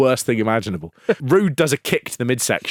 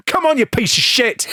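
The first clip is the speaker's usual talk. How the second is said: retold shout; 10 semitones higher than usual. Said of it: "he starts just shouting at Mark Starr".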